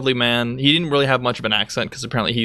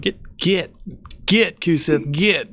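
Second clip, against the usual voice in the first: higher, shooing the dog; talking to dog; 6 semitones higher, slower than usual. Retold or imitated. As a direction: shoo the dog away. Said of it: "every time I'm talking to my dog".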